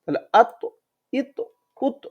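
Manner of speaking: আত, ইত, উত with the ত released in a bounce; bounced final consonant. Shown as a demonstration. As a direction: let ত spring back after each vowel. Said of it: "কলকলা করে পড়ব". (ত-তে ধাক্কা দিয়ে কলকলা)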